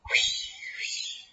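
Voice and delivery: soft whispered wind noise; voiceless, hushed whooshes; whooshing wind. A narrator's reading. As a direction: imitate gusts with breath alone. Imitates the stormy wind.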